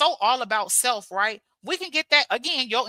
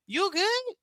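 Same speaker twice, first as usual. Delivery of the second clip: husband's puzzled question, pitched up; puzzled husband checking on her; imitating husband; 7 semitones higher than usual. Imitating a puzzled husband asking his wife. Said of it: "My husband be like".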